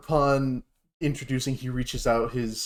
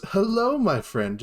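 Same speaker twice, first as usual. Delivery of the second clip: bright, louder hello, lightly voiced; dragonborn mentor greeting; 9 semitones higher, 4 dB louder than usual. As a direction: welcome a new friend. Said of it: "He goes".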